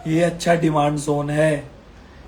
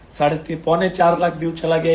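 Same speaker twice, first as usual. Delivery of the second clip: normal talking voice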